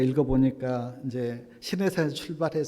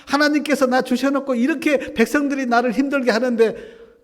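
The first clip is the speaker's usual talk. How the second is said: loud, much higher exasperated protest; talking back to God; 9 semitones higher, 8 dB louder than usual. Retold or imitated. Moses confronting God over the people, loud exasperated protest. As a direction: fling the questions back at God. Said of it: "하나님 앞에 막 대들더라고".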